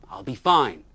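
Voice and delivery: loud reassuring call; calling into the wind. Acted. Bear calling back to his wife, loud. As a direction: call back over the storm.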